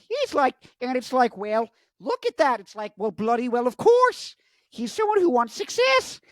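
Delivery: high, strained put-on voice, rising to loud exclamation; exasperated high caricature of a professor; Jordan Peterson impression. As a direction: build into high-pitched indignant mimicry. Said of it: "It's like Jordan Peterson impression".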